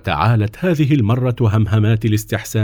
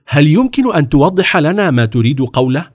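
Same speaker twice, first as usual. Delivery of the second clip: higher-pitched, puzzled question; astonished; 5 semitones higher, 4 dB louder than usual. A narrator's reading. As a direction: ask for an explanation in surprise. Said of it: "وقال السلطان في دهشة".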